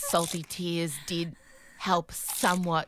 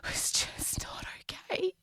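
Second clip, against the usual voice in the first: whisper; 5 semitones lower, 5 dB quieter, slower than usual. Retold or imitated. Whispered confession of hunger.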